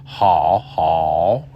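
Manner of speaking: "how" from deep in an open throat. Shown as a demonstration. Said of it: "go deeper in the throat".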